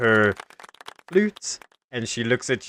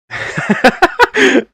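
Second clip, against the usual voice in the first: loud high cackle; 11 dB louder than usual. Acted. GM laughs loudly in character.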